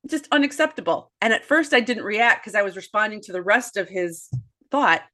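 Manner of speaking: ordinary talk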